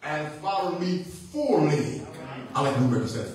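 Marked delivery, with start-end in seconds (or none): 0.0-2.0 slow, deliberate scripture reading; real slow reading. Real, not acted. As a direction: draw the phrase out for careful listening. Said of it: "I'm gonna read it real slow".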